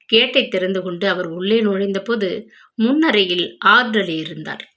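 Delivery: usual speaking voice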